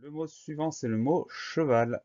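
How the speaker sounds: normal talking voice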